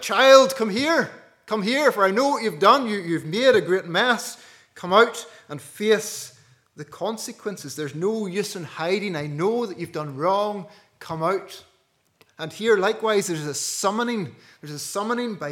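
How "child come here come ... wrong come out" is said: stern parental summons, louder and higher at first; calling out to a child. Acted. Parent calls out the child who made the mess, stern and loud. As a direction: call the guilty child out of hiding like a firm parent.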